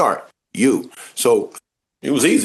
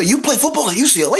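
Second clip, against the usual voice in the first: much higher, astonished voice; amazed question; 11 semitones higher than usual. Retold or imitated. Director astonished that the actor plays at UCLA, much higher. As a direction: sound floored by it.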